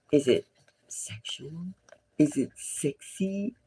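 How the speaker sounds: hushed whisper, leaning in to share a secret; gossipy whisper. Real, not acted. Tarot reader whispering gossip about the outfit.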